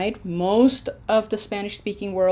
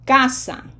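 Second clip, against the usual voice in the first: Casa with CA louder and higher, sa falling; stress on CA of Casa; 4 semitones higher, 5 dB louder than usual. Shown as a demonstration. Teacher repeating the correct word for students, Casa stressed on first syllable. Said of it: "pronunciation emphasis is on syllable number two".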